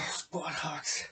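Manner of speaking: breathy whispered words; whispering. Real, not acted. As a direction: whisper it between reps. Breathless during squats.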